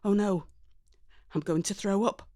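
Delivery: ordinary delivery, nothing marked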